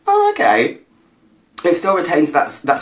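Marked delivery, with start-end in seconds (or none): 0.1-0.8 high, bright "oh, okay" of discovery; pleasantly surprised. Retold or imitated. Reviewer voicing her own nose won over. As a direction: sound won over at last.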